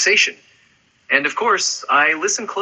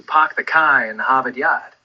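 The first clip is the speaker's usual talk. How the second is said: "park the car in Harvard Yard" with the R sounds dropped, Boston style; Boston accent. Shown as a demonstration. Asked for a thick Boston accent, drops the Rs Boston style. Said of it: "it's all about dropping those R sounds".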